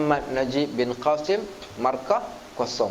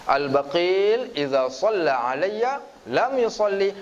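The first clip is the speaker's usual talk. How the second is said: slow, measured Arabic recitation; hadith recitation; 3 semitones higher, slower than usual. Acted. Preacher repeating the hadith for the audience, slow. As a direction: recite it again, deliberate and slow.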